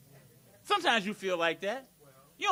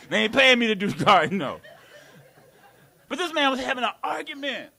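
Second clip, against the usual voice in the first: usual speaking voice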